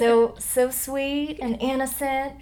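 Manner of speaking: put-on slow, sweet Southern belle accent. Actress hamming up a slow Southern belle drawl. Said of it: "Southern Belle".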